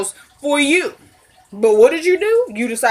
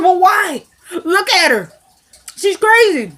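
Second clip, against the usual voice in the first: mock indignant; 8 semitones higher, 4 dB louder, slower than usual. Girlfriend indignant about wife.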